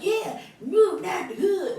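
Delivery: high mocking echo; exaggerated imitation with a laugh. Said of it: "looked like they was mocking".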